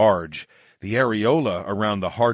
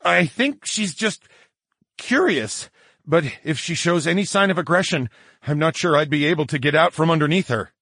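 higher, strained nervous explanation; 8 semitones higher than usual. Acted. Charles pinned, reassuring the women, nervous. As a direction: talk uneasily, pitched well up. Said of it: "I stammered".

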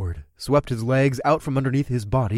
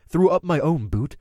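plain reading voice

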